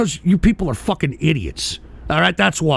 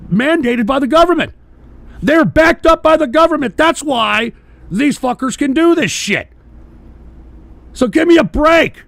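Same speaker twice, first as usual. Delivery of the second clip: angry outburst; 6 semitones higher, 4 dB louder than usual. Real, not acted. Ranting about government backing.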